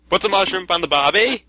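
high, mock Aussie accent; squeaky-high imitation Australian accent; funny Australian voice. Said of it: "do it with a funny voice".

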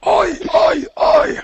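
very high, loud shouted chant; echoing battle cry. Pretend. Answering a chant echoing through the pit, high mock shout. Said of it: "it's echoing throughout the pit".

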